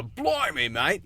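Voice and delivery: high-pitched hammed-up Australian accent; cartoonishly broad Aussie exclamation; over-the-top Australian. Shown as a demonstration. Actor showing what to avoid, overdone Australian accent. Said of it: "instead of, like, a".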